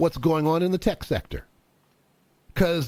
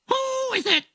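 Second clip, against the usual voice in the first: very high parrot squawk. Acted. Bird at the door squawking at the plumber, very high. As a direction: screech it like a parrot. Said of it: "The bird says".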